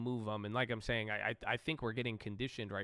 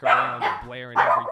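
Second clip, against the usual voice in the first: loud, raised voice about the blaring dad; loud retelling; 15 dB louder than usual. Retold or imitated. A host complaining about a loud dad on the phone, loud and worked up. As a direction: get loud and animated as you tell it.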